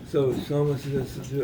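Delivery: ordinary delivery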